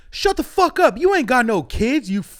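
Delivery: loud, high furious yell; angry yell. Retold or imitated. Homeless man yelling up at the comic's window, loud angry yell. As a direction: scream it back up at the window. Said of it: "looks at me through my window and goes".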